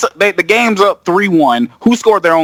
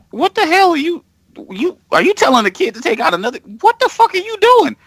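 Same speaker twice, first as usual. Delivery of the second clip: outraged disbelief; 11 semitones higher than usual. Retold or imitated. Watching a coach order kids to injure, rising outraged. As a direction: pitch shooting way up in outrage. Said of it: "And I'm like".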